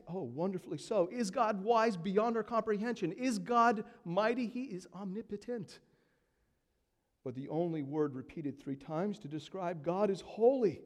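ordinary talk

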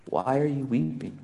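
tender hushed question. Acted. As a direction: ask it quietly, as if to someone weeping. Jesus' words, tender contrast.

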